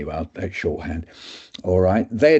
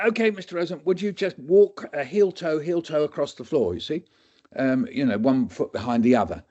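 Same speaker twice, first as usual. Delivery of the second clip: higher, gentle instructions; instructing doctor; 6 semitones higher than usual. Retold or imitated. Doctor tells patient to walk, lightly higher. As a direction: guide the patient along.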